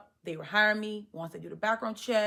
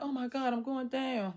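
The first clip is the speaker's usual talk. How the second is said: high-pitched mock panic; a touch of dread, voice pitched up; retold panic; 6 semitones higher than usual. Reliving being grabbed by loss prevention, panicked. Retells being caught by store security.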